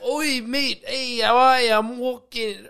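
high, hammed-up Aussie introduction; goofy high-pitched Aussie voice; mock Australian accent. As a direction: do a clumsy cartoon Australian. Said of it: "how our Australian accent is".

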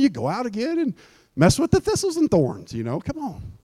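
a hint of a patient shepherd scolding; fond shepherd. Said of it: "he goes".

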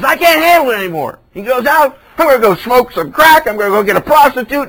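the struggling brother giving in to temptation, loud and fast; desperate outburst. Acted. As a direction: burst out like a man who has given up. Brother breaking down in despair.

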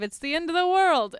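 put-on loud, high drunken wail; drunk doomsayer. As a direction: howl it like a drunk prophet of doom. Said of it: "And he's like".

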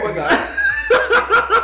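squealing high through laughter; grossed out, laughing hard; disgusted laughing. Pretend. Reacting to gross hand picture.

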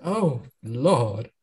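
mock Southern belle swoon. Fanning herself at the bar, mock Southern Belle gasp. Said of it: "do your Southern Belle voice".

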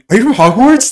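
high and loud, thrilled; excited tease. Real, not acted. Student teasing about a professor title, excited and loud. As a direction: squeal it like a fan.

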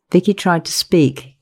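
plain reading voice

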